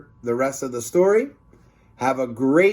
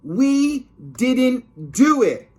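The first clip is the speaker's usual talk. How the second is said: put-on high, loud, slow kid's wail; slow high wailing denial; drawn-out whiny protest; 11 semitones higher, 5 dB louder, much slower than usual. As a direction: stretch the complaint out. Repeat protest.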